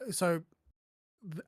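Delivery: usual speaking voice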